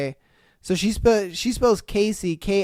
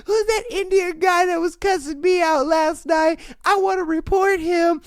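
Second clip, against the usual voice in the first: very high, loud screechy lady voice; high-pitched mock Karen complaining; shrill complaining lady; 12 semitones higher, 4 dB louder than usual. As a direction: squeal like an outraged customer. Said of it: "be like".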